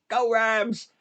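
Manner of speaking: a hint of a fan yelling Go Rams; mock stadium cheer. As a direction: replay the Rams chant lightly. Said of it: "I was screaming a lot".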